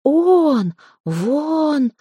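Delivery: high, drawn-out words of alarm; stretched, high-pitched gasps of fear; frightened cry. A narrator's reading. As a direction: cry it out as if spotting a monster. Terrified girl sees the monster.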